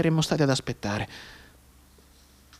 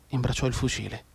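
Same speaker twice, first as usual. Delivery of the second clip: plain reading voice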